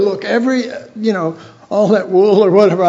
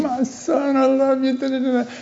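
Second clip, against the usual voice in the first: higher and softer, a loving father hugging his son; tender father's embrace; 6 semitones higher, 5 dB quieter than usual. Retold or imitated. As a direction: murmur it fondly as the Father. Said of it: "the Father comes up and just hugs him".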